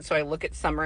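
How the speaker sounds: normal talking voice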